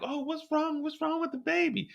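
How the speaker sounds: high, soft fretting; Mireille's worried voice. Retold or imitated. Mireille at work, asking about the baby, high soft mock worry. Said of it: "And she's like".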